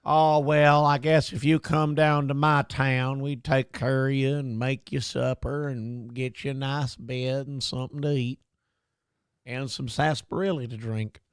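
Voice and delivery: gentle mock Southern hospitality voice; mock Southern accent. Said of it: "anybody with that voice".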